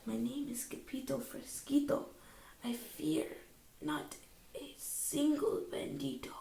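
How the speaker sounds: near whisper, trembling bravado; soft voice. A narrator's reading. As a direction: whisper it, brave but scared. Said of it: "in a muy, muy soft voice he said".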